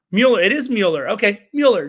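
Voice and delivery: Mueller as MYOO-ler, loud and settled; corrected pronunciation. Shown as a demonstration. Host accepting Joey's correction, emphatic Mueller as MYOO-ler. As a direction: confirm "Mueller" loudly with a y glide. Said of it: "Joey is correcting me".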